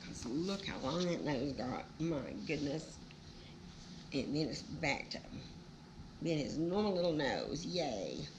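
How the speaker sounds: ordinary delivery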